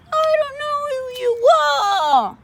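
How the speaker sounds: very high squeaky voice guarding his things; squeaky possessive. Acted. Octopus refusing to share his tools, squeaky high voice. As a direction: squeak it out, clutching your stuff.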